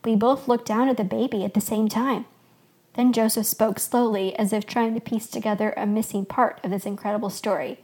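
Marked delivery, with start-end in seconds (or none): none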